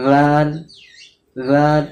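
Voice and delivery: রাল with the tongue lightly on the teeth, air left free; light tongue touch. Shown as a demonstration. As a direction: loose tongue, breath flowing. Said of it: "হালকা শুধু touch করতে হয়".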